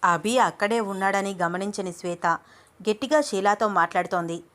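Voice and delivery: ordinary delivery, nothing marked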